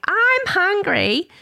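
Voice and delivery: high, very drawn-out playful announcement; playful child. Retold or imitated. Daughter coming in to start a game, playfully imitated, high and stretched. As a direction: sing it out slowly like a teasing child. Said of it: "in a playful way".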